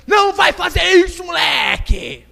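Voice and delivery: high, drawn-out scolding shout; pai gritando. Acted. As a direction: yell at the kid like an angry father. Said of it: "o pai grita". (grito arrastado de pai bravo)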